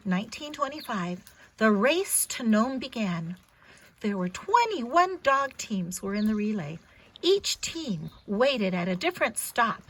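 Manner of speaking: gentle storybook read-aloud; clear, expressive storyteller voice for a little one; reading to a child. Acted. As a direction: read it like a bedtime picture book. Reads picture book to child.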